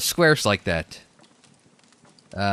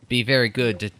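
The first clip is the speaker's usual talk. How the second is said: ordinary delivery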